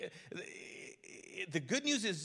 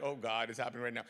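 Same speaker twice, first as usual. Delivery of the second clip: mock dread; 8 semitones lower, faster than usual. Acted inner panic.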